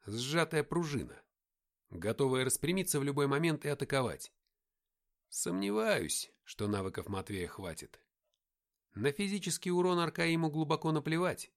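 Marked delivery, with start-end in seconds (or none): none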